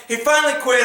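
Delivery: usual speaking voice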